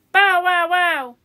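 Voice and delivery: storybook bow-wow barks; dog barking. A narrator's reading. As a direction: imitate a barking dog in words. Dog chasing cat in story.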